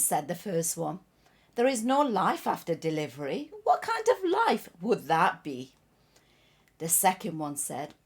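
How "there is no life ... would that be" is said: lightly acted scoffing challenge, rising; skeptical baby. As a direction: dismiss it with a louder rhetorical question. Acting skeptical baby in parable.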